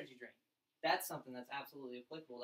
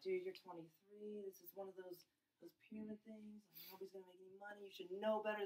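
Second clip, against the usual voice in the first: much softer, hushed scolding; lecturing friend; 3 semitones higher, 9 dB quieter than usual. Retold or imitated. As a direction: lean in and scold him under your breath. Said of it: "be like".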